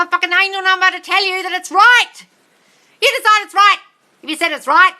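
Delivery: high, loud, forceful yelling; screaming rant. Real, not acted. Host screaming at viewers that the power is theirs. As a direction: hammer every phrase home at full voice. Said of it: "Guys, I scream a lot".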